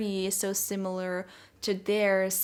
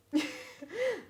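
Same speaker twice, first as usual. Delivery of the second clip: soft giggle; 10 semitones higher, 5 dB quieter than usual. Real, not acted. Laughs at the card layout.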